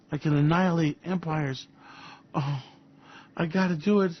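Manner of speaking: put-on breathy, gleeful toy-boy Trump; mock Trump. Trump talking to himself with the nuclear football, mock glee. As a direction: play him like a kid with a toy. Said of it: "He goes".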